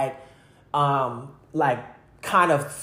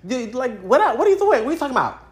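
incredulous; 9 semitones higher than usual. Real, not acted. Incredulous at dad.

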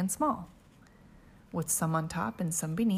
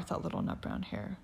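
plain reading voice

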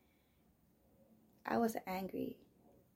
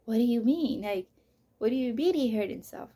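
loud, incredulous demand; raised voice, refusing to believe it; losing it; 11 dB louder than usual. Retold or imitated. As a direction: push back hard in alarmed disbelief. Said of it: "I started losing my shit".

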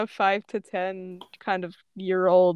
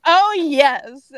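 loud, raised gloating reply; 6 semitones higher, 7 dB louder than usual. Acted. Shatton answers Ash's "oh no", loud gloating. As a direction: relish it, loud and high.

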